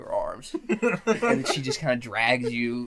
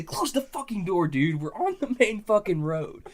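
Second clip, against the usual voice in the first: raised, higher-pitched retold yell; alarmed scolding; 6 semitones higher, 4 dB louder than usual. Driver to drunk friend opening the door on the main road, alarmed scolding. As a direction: scold him urgently, voice pushed up.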